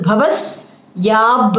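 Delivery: bhavasyab slowly, s and final b held; held consonants. Shown as a demonstration. Teacher showing students the hold, bhavasyab with held b. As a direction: close and hold the b ending bhavasyab. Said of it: "Hold the ib".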